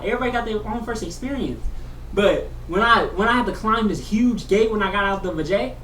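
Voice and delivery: usual speaking voice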